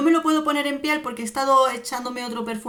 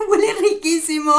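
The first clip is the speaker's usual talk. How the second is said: laughing; 8 semitones higher, 3 dB louder than usual. Real, not acted. Delighted first sniff of perfume.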